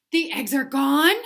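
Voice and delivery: mock gasp; relieved surprise. Gerald relieved.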